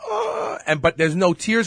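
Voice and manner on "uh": high-pitched whiny mock sob; weary fake-crying voice. Host mimicking women who cry without tears, mock weary whine. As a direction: imitate a tearless, squeezed-out crying moan. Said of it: "They'll talk in that weary voice".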